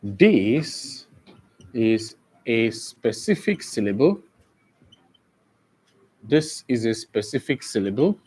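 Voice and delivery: teacher dictating notes in short chunks; slow dictation. Real, not acted. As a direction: leave long pauses so students can write. Dictates a definition to the class.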